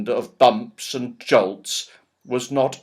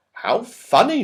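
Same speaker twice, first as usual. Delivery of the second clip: astonishment; 12 semitones higher, 5 dB louder than usual. Acted. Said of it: "said Beth in astonishment".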